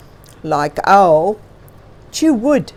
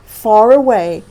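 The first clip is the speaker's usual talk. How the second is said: ordinary delivery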